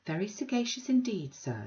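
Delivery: put-on high, genteel old lady; prim Mrs Sparsit. Mrs Sparsit primly questioning Bounderby, high and genteel. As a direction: lift it into a prissy, mannered voice.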